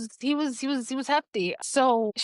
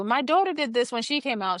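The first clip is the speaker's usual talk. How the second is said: normal talking voice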